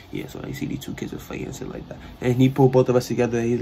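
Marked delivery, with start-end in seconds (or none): 0.1-2.0 hushed whisper, barely voiced. Coach quietly pulling two fighting kids aside, whispering. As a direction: keep it secret, just breath.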